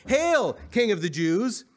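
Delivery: loud, slow mock salute; mocking taunt. A narrator's reading. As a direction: draw out a jeering hail. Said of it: "in mockery and taunted".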